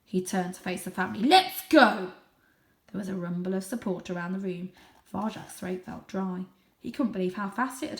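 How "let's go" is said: loud rallying order; ordering father. Acted. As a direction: lead them away.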